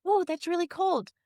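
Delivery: mock surprise. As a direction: pretend the cold just hit you. Voicing her body feeling cold.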